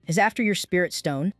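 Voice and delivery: ordinary delivery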